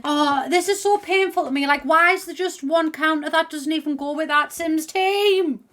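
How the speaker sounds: high, pained wail of complaint; exasperated whine. Real, not acted. Player pained by a counter that doesn't match, exasperated whine.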